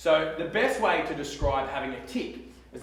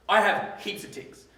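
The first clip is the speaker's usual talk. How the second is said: ordinary talk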